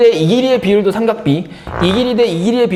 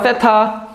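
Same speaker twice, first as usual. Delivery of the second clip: 세타 stretched out with emphasis; stressed 세타; much slower than usual. Shown as a demonstration. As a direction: model 세타 for repeating, accent exaggerated. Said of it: "여기 강세 있어요".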